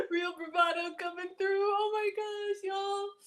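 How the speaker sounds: real giggling fit; laughing. Real, not acted. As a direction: crack up mid-line, then a slow, drawn-out "oh my gosh". Laughing at her own eating.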